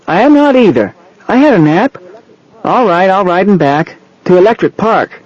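put-on high-pitched protesting child; high little-kid voice, indignant; small boy. A narrator's reading. Small boy protests, then gives in.

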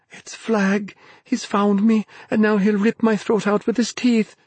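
high-pitched, rising panic; terrified thought. A narrator's reading. Dennis fearing his throat will be ripped out, terrified. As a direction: think aloud in sheer terror. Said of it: "sleep-fuddled mind thought".

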